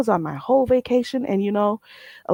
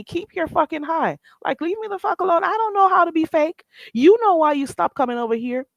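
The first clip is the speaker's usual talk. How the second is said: higher, heated telling-off; real anger; 8 semitones higher than usual. Real, not acted. Scolding Tracy for saying hi in other chats, heated telling-off. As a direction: snap it at the chatter.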